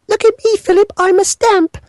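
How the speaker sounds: hammed-up royal falsetto, pleased with herself; Queen voice. Mocking the Queen tucked in bed, high falsetto. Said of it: "the Queen pulls the duvets up ... and she goes".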